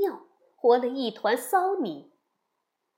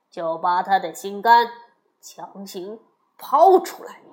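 low villain voice with hard, loud stresses; forceful, menacing court adviser; scheming royal father-in-law; 4 semitones lower, 4 dB louder than usual. A narrator's reading.

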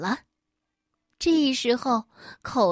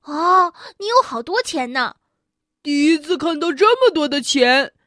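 amazed classmates; 3 semitones higher, 7 dB louder than usual. Acted. Classmates marvel at money.

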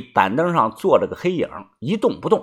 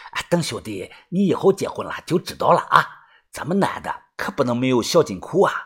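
soft, knowing counsel; worldly-wise advice; 3 semitones lower, 7 dB quieter than usual. Acted. Teenager advising his friend about secret savings, mock-mature. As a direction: advise like an old hand, voice kept down. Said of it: "一脸老成的告诫我说".

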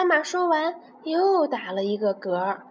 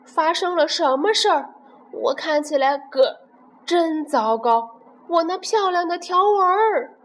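panicked; 7 semitones higher, 4 dB louder than usual. Acted. Zebra panics about stripes.

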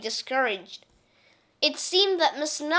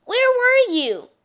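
much higher and louder eager call; excited question; 13 semitones higher, 11 dB louder than usual. Acted. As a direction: shout it out with delight. Kids greet returned teacher.

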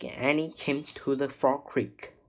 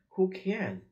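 higher-pitched, understated pirate roar; mild roar; 7 semitones higher than usual. A narrator's reading. Pirate captain brushing off a complaint, mildly roared. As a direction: roar it, but keep it contained. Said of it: "roared the Captain Bone".

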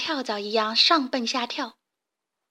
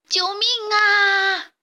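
drawn-out, higher, louder wail; panicked cry for help; 9 semitones higher, 5 dB louder, much slower than usual. Acted. Weasel sinking in quicksand cries for help, panicked high wail. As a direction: scream for help as you sink. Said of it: "黄鼠狼惊慌失措地大叫".

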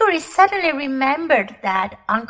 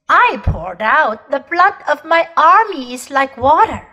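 commanding, louder queenly voice; proud imperious queen; 5 dB louder than usual. Acted. Queen boasting to the children of her war, imperious. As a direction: declaim it like a cold, proud empress.

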